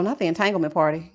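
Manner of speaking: normal talking voice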